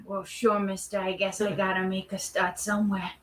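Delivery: low, soft aw-shucks country voice; a shy hopeful nobody taking what he can get; humble aw-shucks horse. Acted. Talking pony accepting an extra role, low and soft.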